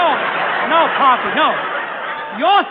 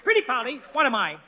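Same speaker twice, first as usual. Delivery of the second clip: parrot prompt; 3 semitones lower, faster than usual. Shown as a demonstration.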